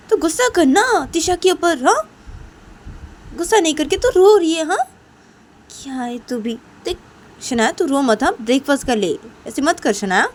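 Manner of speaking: high, exasperated big-sister voice; insistent acted scolding, high then easing; scolding elder sister. Elder sister scolds crying Shanaya.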